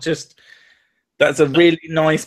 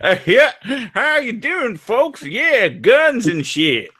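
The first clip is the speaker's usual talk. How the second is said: pretend American accent; 10 semitones higher, much slower than usual. Streamer mocking Americans for friends, exaggerated American accent. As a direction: put on a brash American, pitched way up. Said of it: "I'll pretend to be American".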